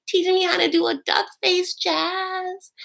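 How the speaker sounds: very high, put-on squeal; mock selfie voice. [name] posing for selfies with Jasmine, mocking high voice. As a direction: pipe it up in a silly high voice.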